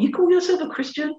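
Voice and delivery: high sneering taunt from the devil; mock the believer as the accuser would; high, scornful accuser's question; accusing devil. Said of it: "he comes in a different".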